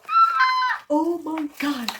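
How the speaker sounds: high, loud squeal then slow gasp; thrilled shriek; excited squeal. Real, not acted. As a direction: squeal with delight. Shocked reaction at unboxing.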